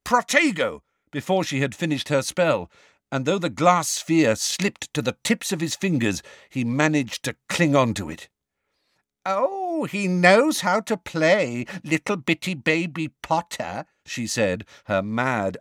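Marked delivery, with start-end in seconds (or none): 0.0-0.8 high, urgent shouted spell. A narrator's reading. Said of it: "He shouted". 9.2-13.9 mock baby talk. A narrator's reading. Bellatrix mocking baby talk.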